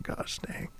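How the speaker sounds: mock exasperated whisper; whispered imitation. Host imitating producer's absurd look, whispered. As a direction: whisper it like a stunned onlooker.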